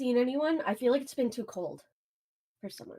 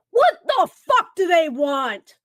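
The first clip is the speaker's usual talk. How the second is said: loud, slow mock yell at the door; retold angry yell; 6 semitones higher, 11 dB louder, much slower than usual. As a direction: bellow it like an angry brother. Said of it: "like, yelling, like, angry".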